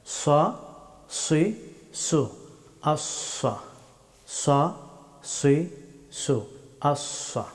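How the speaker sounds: "সা, সি, সু" as heavy, rounded Arabic swa, swi, su; full-mouthed sad. Shown as a demonstration. Teacher drilling the letter sad, heavy full-mouthed s. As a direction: fill the mouth on each s of "সা, সি, সু". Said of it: "মুখ ভর্তি করে উচ্চারণ".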